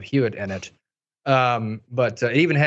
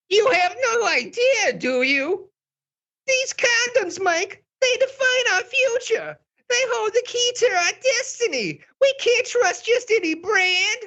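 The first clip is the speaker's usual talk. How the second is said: very high, tearful drama queen wail. Acted. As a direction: wail it like a stage diva close to tears. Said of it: "this is my drama queen voice".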